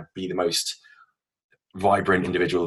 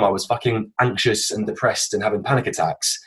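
normal talking voice

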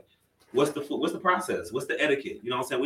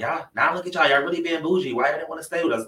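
mock grandmother; 7 semitones higher, 4 dB louder than usual. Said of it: "she's like".

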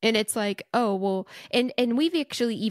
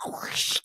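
whooshing spray noise; 5 dB quieter than usual. Retold or imitated. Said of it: "she was like".

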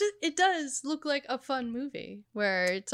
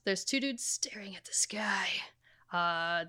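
whispering; 4 semitones lower, 11 dB quieter than usual. Real, not acted. Describing silent trailer quietly.

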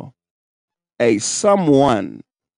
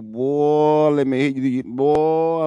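ordinary talk, nothing marked

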